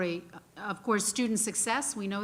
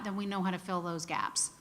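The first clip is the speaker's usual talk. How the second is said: ordinary talk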